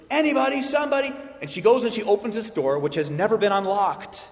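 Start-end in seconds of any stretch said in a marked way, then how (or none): 0.1-1.1 high, loud mock cry for help; a girl calling for help, higher and louder. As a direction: call out like a frightened girl. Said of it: "crying out for somebody".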